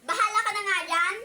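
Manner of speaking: loud, fed-up dismissal; angry. Pretend. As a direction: give up on her angrily. Storming off in quarrel. (inis na pasigaw)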